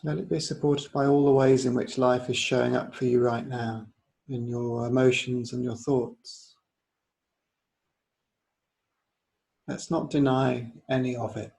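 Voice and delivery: unhurried yoga nidra guidance; guided meditation. Real, not acted. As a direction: guide them gently inward, leaving space between phrases.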